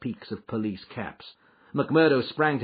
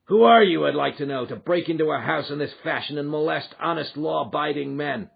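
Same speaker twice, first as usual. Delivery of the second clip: outraged, much louder and higher at first; indignant; 7 semitones higher, 7 dB louder than usual. Acted. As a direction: demand who dares barge in. McGinty outraged at the police.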